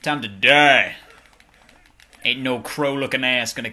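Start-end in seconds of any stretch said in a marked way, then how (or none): 0.0-0.9 loud threatening shout; battle shout. Acted. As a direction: bellow it at the enemy.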